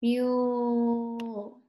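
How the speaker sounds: "Mule" drawn out evenly; slow. Shown as a demonstration. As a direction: hold the long U in "Mule" on a level note. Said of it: "Can you say that slowly?".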